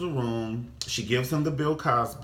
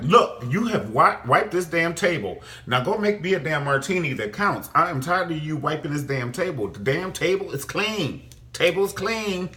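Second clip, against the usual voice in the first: imitating an irritated diva snapping at a server, higher and louder; mock angry rant; 7 semitones higher, 5 dB louder than usual. Veronica scolding the server, hammed-up angry rant. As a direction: scold the server like a fed-up rich lady. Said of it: "So she was like".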